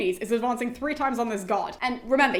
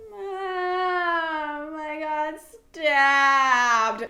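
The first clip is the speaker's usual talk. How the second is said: long, drawn-out high wail swelling louder; mock crying; 4 semitones higher, 3 dB louder, much slower than usual. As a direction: bawl theatrically to your mother. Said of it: "she starts crying to Dione".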